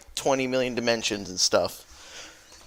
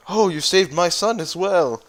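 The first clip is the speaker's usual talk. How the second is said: higher, fast gushing thanks; grateful father; 4 semitones higher than usual. Retold or imitated. Father thanking the thief again, high gushing thanks. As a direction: gush out the gratitude. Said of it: "he's like".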